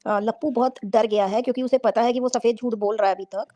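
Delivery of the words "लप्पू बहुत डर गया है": normal talking voice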